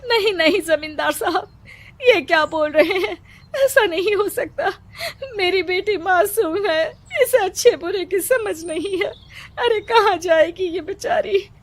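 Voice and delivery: high, crying plea to the landlord; mother weeping as she begs the zamindar; tearful pleading. Acted. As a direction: sob out the begging for your daughter.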